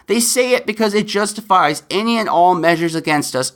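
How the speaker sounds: ordinary delivery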